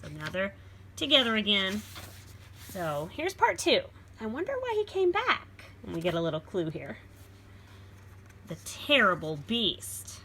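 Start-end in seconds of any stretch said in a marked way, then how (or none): 4.2-5.5 put-on lively read-aloud for children; storybook reading. A narrator's reading. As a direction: read it like a picture book to a class. Reads book title.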